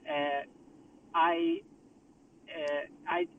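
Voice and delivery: usual speaking voice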